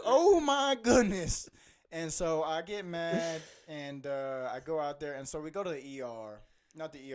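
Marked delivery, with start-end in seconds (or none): 0.0-1.5 put-on slow, high, loud shocked old man; hammed-up old friend's gasp; old man exclamation. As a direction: stretch it out like an eighty-year-old. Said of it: "he goes".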